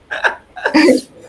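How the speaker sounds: a loud unvoiced burst of laughter; breathy laugh. Real, not acted. Teacher laughing at her own classroom story, loud breathy laugh. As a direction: laugh out hard without voice.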